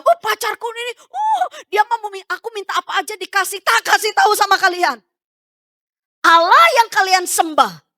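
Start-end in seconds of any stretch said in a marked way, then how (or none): 0.0-3.6 boasting lover. Pretend. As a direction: play someone gushing about their sweetheart. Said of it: "kamu bilang". (pura-pura membanggakan pacar)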